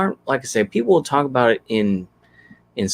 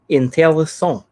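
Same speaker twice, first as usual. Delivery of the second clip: French word, pitched up; French accent; 6 semitones higher, 3 dB louder than usual. Retold or imitated. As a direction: say it the French way, higher. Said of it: "as the French say".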